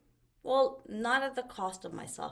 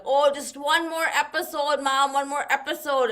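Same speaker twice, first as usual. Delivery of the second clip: louder, higher mock child plea; imitating kids pleading for more TV; kids begging; 7 semitones higher, 10 dB louder than usual. Mom imitating her kids begging to keep watching, pleading.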